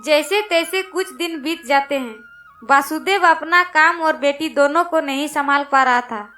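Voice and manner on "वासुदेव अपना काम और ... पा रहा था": usual speaking voice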